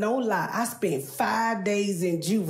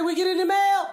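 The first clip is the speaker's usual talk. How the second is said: higher, louder mimic of mama asking; mama's voice; 8 semitones higher, 3 dB louder than usual. Comic who plays her mother asking about mail. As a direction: play the nagging mom sending kids. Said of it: "Mama say".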